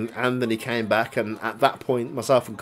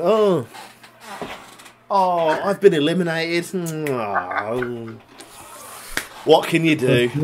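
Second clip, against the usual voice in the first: higher, hammed-up "oh no" act; mock dismay; 5 semitones higher, slower than usual. Wrestler feigning surprise at his own elimination, mock dismay. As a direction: put on exaggerated dismay at being knocked out.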